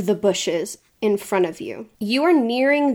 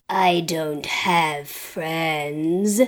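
very slow, low villain voice; menacing cult member; 5 semitones lower, 3 dB quieter, much slower than usual. Acted. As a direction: drag each word out low, as the hesitating brute. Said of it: "and he says".

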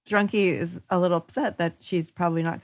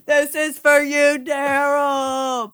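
high, loud dedication with a drawn-out last word; mock-heroic cry; 8 semitones higher, 4 dB louder, much slower than usual. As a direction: call it out like a comic battle cry. Player's mock-heroic cry in game.

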